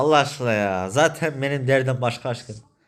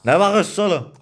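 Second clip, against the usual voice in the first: higher and louder irritated challenge; annoyed snap; 6 semitones higher, 5 dB louder than usual. Acted. Snapping angrily at a man staring at him. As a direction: bark it at whoever is staring.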